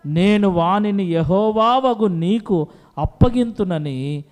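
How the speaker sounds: loud, slow, measured verse; solemn scripture reading. Acted. Preacher reads Hannah's prayer, loud and slow. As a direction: read the vow with weight.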